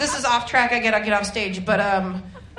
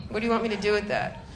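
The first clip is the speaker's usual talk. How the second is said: ordinary talk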